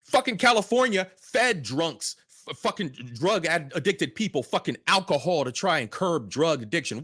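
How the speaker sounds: ordinary talk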